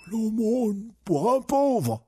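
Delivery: high, slow beggar's plea; quavering, drawn-out begging; begging old man. Acted. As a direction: drag out the plea like a frail blind beggar. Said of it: "réclama le vieil aveugle".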